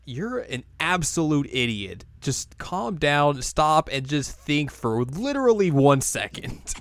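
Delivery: mock patient scolding of a hothead; exasperated Miroku. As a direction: talk him down slowly, spelling it out. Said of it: "And Moroku's like".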